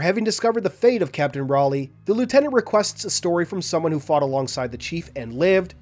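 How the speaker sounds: ordinary talk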